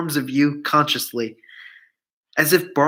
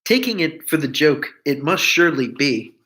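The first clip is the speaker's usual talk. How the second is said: ordinary delivery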